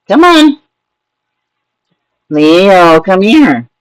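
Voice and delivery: very high coaxing call; talking to a cat. Real, not acted. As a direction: coax the cat down in a squeak. Calling the cat.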